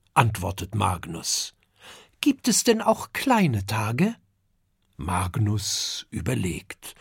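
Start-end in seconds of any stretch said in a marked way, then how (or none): 2.2-4.2 put-on high, curious child voice; little dragon Kokosnuss asking his father, high and curious. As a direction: ask it as an eager small boy.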